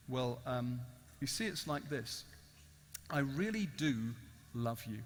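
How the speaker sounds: very soft, low, gentle reply; tender confession. Acted. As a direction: explain it quietly, with hesitant pauses. Stranger's tender answer to the freed prisoner.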